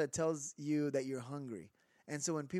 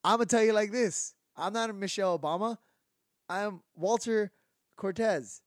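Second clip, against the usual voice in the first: loud, rousing mock speech; hammed-up preacher-style call to drink water; mock rally speech; 5 semitones higher, 6 dB louder than usual. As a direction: pump up the crowd like a campaign rally. Mock campaign speech about water.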